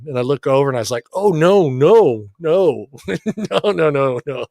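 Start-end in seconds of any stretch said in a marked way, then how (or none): 1.1-4.5 mock dismay. Dad seeing the pile of cash, mock dismay. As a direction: act out dawning alarm, then crack up. Retold alarm, then laughing.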